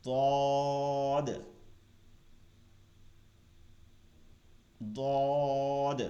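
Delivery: "দদ" said as Arabic ض with the side of the tongue, thick and held; Arabic dad sound. Shown as a demonstration. Tutor repeating "দদ" for learners to copy, heavy. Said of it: "দদকে দদ বলতে হবে".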